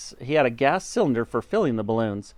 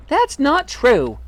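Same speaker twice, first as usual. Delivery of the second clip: high, loud, drawn-out protest; wife crying out; 13 semitones higher, 6 dB louder, slower than usual. A narrator's reading. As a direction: cry out slowly in high-pitched denial. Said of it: "cried Miss Twit".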